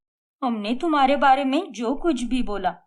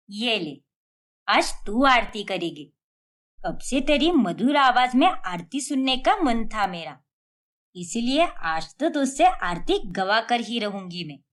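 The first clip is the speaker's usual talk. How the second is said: ordinary talk, nothing marked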